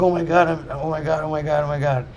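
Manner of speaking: high, breathless repeated gasps of oh my God; mock panic, doubled over in pain; panicked pain. Walking back into the ER in agony, panicked.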